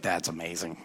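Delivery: very soft, low imitated marvel; hushed amazement. Friend hearing about the wrestling angel, hushed amazement. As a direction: quote him quietly amazed.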